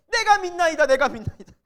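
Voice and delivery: loud, high, clinging cry of faith; desperate plea. Acted. Father crying out to Jesus for his son, desperate and loud. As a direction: beg with all your strength. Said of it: "매달리는 거예요".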